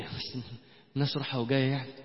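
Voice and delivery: low, soft, hesitant mumble; embarrassed objection. Retold or imitated. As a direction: voice a sheepish friend, small and low. Embarrassed friend objects in retold dialogue.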